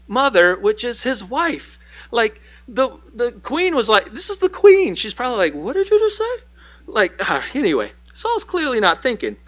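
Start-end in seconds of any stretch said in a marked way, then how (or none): 4.1-4.9 very high, loud huffy queen; mock indignant queen. Queen retorts after insult. 5.5-6.4 very high, outraged "what did you just say"; mock offended gasp. Queen reacts to insult.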